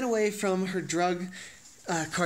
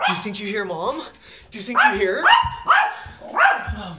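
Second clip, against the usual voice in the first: excited teasing; 4 semitones higher, 7 dB louder than usual. Real, not acted. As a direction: hype the dog up.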